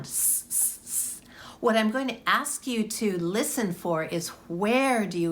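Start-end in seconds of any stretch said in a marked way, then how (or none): 0.0-1.2 long unvoiced hiss, s s s; hissing s sound. Shown as a demonstration. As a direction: hiss the s like a sizzling sausage. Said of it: "that sizzling sausages sound".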